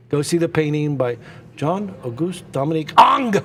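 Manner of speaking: "Ang" with a French nasal vowel. Shown as a demonstration. Telling visitors to the Louvre how the French say it, French "Ang". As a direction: end the name on a French "Ang". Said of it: "That's how they say it".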